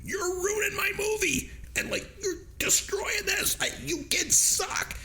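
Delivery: imitating an angry director, very high-pitched; high, strained mock tirade at child actors; mock director's yelling. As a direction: act out a director screaming at kids, pitch way up. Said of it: "started yelling at them".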